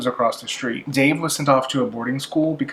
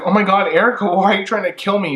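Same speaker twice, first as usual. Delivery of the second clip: shocked; 6 semitones higher, faster than usual. Retold or imitated. Melissa unmasks her attacker.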